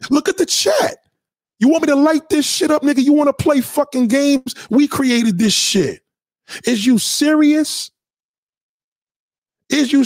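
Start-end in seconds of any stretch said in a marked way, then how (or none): 0.1-5.4 hyped up. Real, not acted. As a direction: get fired up like the song just dropped. Hyped up reacting to the track.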